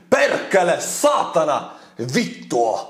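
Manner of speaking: Perkele punched high, then Satana, Vittu falling; showing how Finns swear; Finnish swears. Shown as a demonstration. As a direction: spit out Perkele, Satana, Vittu like real curses. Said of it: "That's how you say it".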